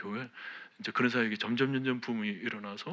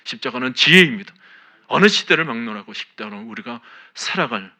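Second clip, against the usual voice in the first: loud, ringing preacher's exhortation; fervent sermon delivery; full-force preaching; 10 dB louder than usual. Acted. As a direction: preach it out with conviction to the whole church. Preaching to the congregation.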